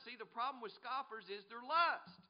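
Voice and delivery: high, loud sermon delivery; forceful preaching. Real, not acted. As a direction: drive it at the congregation.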